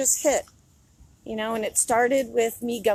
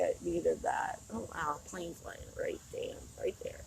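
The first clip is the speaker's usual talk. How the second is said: hushed; 5 semitones lower, 14 dB quieter than usual. Real, not acted. Hushed remark about a plane.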